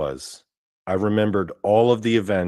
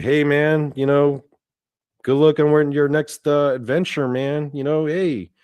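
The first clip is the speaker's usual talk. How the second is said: cheerful send-off; 6 semitones higher than usual. Retold or imitated. The team waving the kid off into the light, bright higher call. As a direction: call up to him like cheering a buddy off.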